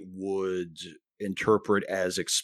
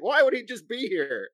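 mock baffled Andy; 10 semitones higher than usual. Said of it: "He's like".

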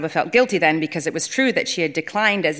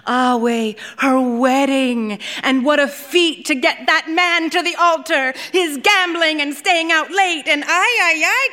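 scoffing aunt; 7 semitones higher, 4 dB louder than usual. Acted. Kanjit mocks the friend's groom.

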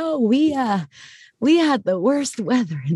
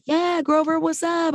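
bright chirpy greeting; 9 semitones higher than usual. Retold or imitated. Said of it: "I was like".

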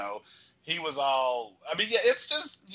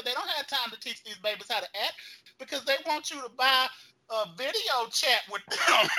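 ordinary talk

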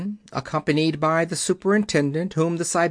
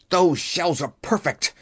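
angry shout; 2 semitones higher than usual. A narrator's reading. Keller argues for the rejected shells, shouting. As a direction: insist forcefully in a put-on angry voice. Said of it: "Keller shouted".

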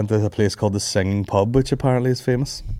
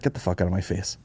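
muttered brush-off; 2 semitones lower, 10 dB quieter than usual. Acted. Hotel guest to someone offering to carry bags, muttered brush-off. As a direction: mutter it under your breath, dropping away. Acted brush-off to bag carrier.

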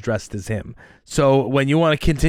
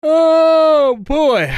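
yawning; 8 dB louder than usual. Real, not acted. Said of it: "I keep yawning".

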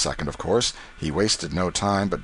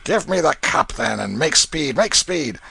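impatient; 7 semitones higher, 4 dB louder than usual. Acted. Canty impatiently accepts.

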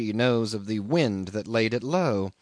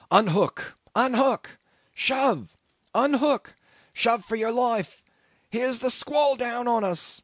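high, urgent shouting; confused shouts; 10 semitones higher than usual. A narrator's reading. Sailors scrambling to launch a boat before the squall, shouting. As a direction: yell out frantic orders, pitched high. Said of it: "mingled with confused shouts".

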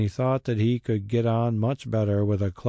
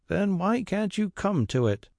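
impatient cry; 10 semitones higher than usual. A narrator's reading. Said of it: "cried the squire".

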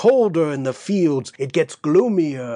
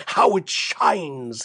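gloating devil; 2 semitones lower, slower than usual. Acted. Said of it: "he roared".

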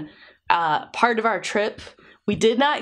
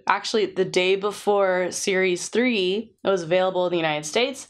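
ordinary talk

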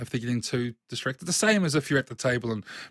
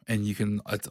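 ordinary talk

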